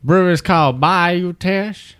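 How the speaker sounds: mouth full of peanut butter. Real, not acted. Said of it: "like he's got peanut butter in his mouth".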